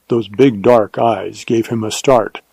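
usual speaking voice

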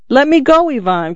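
sharp high cry, then dropping. A narrator's reading. Simon cries out to be let go. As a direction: pull away, crying out. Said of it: "cried Simon".

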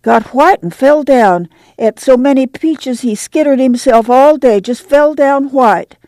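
put-on excited young girl; high child voice. A narrator's reading. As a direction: blurt it out as a girl. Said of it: "Ruthie panted".

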